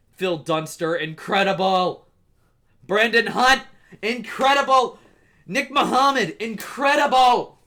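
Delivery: from plain to loud, high praise; building excitement. Real, not acted. Building list of praised actors.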